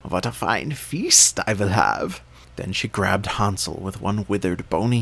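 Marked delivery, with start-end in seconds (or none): 0.0-2.2 put-on slow, gloating old witch. A narrator's reading. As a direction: savor it like a hungry witch. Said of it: "she cackled to herself".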